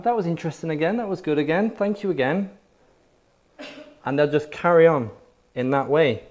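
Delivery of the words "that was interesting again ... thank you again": higher, mock-polite "that's interesting"; polite churchgoer praise. Preacher mocks churchgoers who praise but never believe, higher and light. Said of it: "they'll come back".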